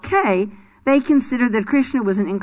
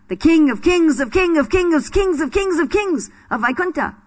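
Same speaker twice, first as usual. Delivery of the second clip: indignant residents; 6 semitones higher than usual. Retold or imitated. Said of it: "and they say".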